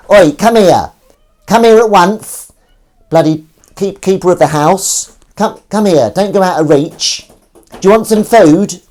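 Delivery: high, loud summons to a pet; calling the cat. Real, not acted. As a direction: call out insistently to a cat out of reach. Calling cat Willow to come.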